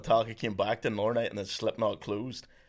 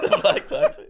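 laughing repeat; 6 dB louder than usual. Real, not acted.

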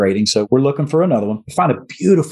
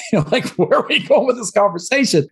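laughing disbelief; 9 semitones higher than usual. Retold or imitated. Awkward reply to shirtless owner.

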